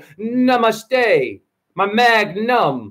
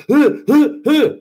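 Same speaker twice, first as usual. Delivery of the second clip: mock heaving gasps, higher; panting heaves; 6 semitones higher, 3 dB louder than usual. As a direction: act out straining breaths while holding a sick friend. Imitating himself heaving at party.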